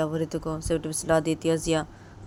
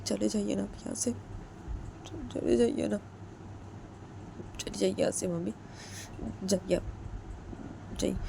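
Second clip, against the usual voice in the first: softer, higher pleading; pleading daughter; 4 semitones higher, 7 dB quieter than usual. Retold or imitated. Jiya softly begging her mother to leave. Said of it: "वो जेकी को बोलती है".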